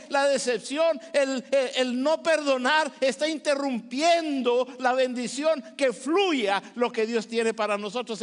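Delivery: usual speaking voice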